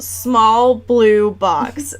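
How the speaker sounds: drawn-out, deadpan quote of the book; slow mocking quote. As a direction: read it out slowly, mockingly.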